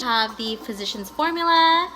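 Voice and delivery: ordinary talk, nothing marked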